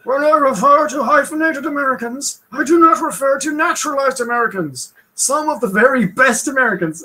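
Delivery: put-on high, old-time speechmaker voice; Teddy Roosevelt impression. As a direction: boom it out like an old president at a podium. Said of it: "however you wanna do Theodore Roosevelt in this".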